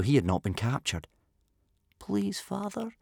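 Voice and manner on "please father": put-on small, high, soft whispering boy; whispered plea. A narrator's reading. Captive boy begs his absent father, whispering. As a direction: whisper it pleadingly into the dark. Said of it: "Bear Cub whispered into the blackness".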